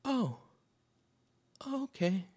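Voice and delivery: high, hesitant, taken-aback reply; awkward cashier impression. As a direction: squeak out an uneasy, polite acceptance. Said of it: "and the lady goes".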